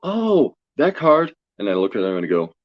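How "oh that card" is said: high, louder mock surprise on that card; surprised knowing oh. Fortune teller recognizing the drawn card, high surprised exclaim. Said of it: "And she goes".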